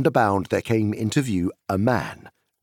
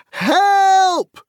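very high, loud shout; desperate loud cry; cry for help; 10 dB louder than usual. Acted. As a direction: yell out for rescue. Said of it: "cried Frodo and Sam".

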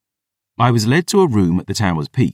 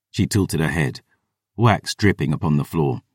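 ordinary delivery, nothing marked

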